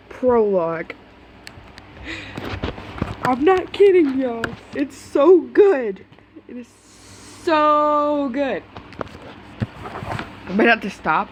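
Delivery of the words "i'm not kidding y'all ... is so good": high slow drawn-out gushing. Real, not acted. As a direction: stretch out how good it is. Gushing about story.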